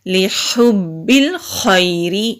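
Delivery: slow, careful Quranic recitation. Shown as a demonstration. Teacher recites the corrected phrase, slow recitation. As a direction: recite it slowly with full tajweed. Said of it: "را کو باریک پڑھیں گے".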